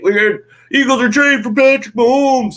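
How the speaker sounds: high, loud mocking yell; mock talker yell. Mocking clueless media talkers with a high, loud fake yell. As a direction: ham up a clueless radio talker shouting a fake rumour. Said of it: "They're just yelling stupid shit".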